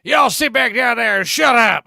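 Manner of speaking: mock bus driver shouting down the aisle; bossy bus driver. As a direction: yell at the kids like a fed-up bus driver. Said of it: "she's like the bus driver".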